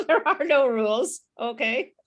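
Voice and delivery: real laugh breaking into the words. Real, not acted. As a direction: say it through a burst of laughter. Laughs at her own warning.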